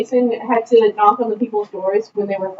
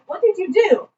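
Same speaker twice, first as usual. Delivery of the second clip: higher-pitched German professor; Armin's thick German accent; 7 semitones higher than usual. Retold or imitated. German professor asks an injured student, thick accent. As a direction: question it in a heavy German accent. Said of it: "saying this was the thickest German accent".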